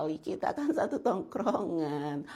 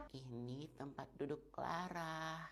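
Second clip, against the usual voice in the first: nearly inaudible, much softer; soft spoken so quiet it vanishes; too soft to hear; 3 semitones lower, 16 dB quieter than usual. Acted. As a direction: mumble it so softly nobody hears. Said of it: "kalau ngomong tuh yang jelas".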